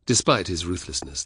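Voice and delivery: ordinary delivery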